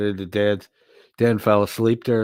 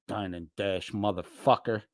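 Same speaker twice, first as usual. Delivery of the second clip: normal talking voice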